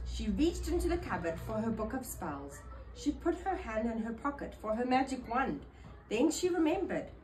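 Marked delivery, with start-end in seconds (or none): none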